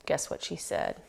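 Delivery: ordinary delivery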